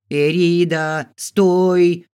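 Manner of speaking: loud, drawn-out call to halt; shouting. A narrator's reading. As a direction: call after a runaway at full voice. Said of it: "закричала хозяйка". (громкий повелительный окрик)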